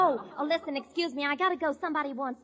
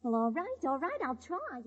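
ordinary talk, nothing marked

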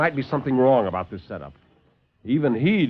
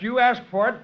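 sharp loud high bark; snapped; 11 semitones higher, 4 dB louder than usual. Acted. Lieutenant snaps at the doctor before firing, loud and high. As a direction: snap it out in anger. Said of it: "I snapped".

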